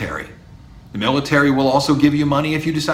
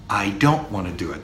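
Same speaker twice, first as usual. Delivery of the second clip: normal talking voice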